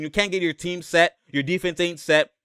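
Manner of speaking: ordinary talk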